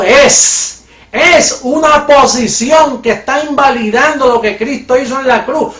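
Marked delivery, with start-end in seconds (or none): 0.0-0.8 es punched louder; the single word es, loud and isolated; stress on es. Shown as a demonstration. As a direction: hit the word es hard, alone. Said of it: "Usted está diciendo".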